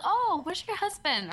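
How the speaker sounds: high, slow, drawn-out imitated question; mock surprised greeting. Restaurant staff greet lone regular customer, imitated high surprise. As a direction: imitate staff noticing someone is missing.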